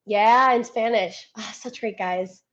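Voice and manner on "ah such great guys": usual speaking voice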